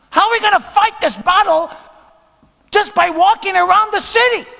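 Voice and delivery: high, loud protest at the leader; incredulous shout. Acted. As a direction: shout it in sheer disbelief. Acting out doubting soldiers to Joshua.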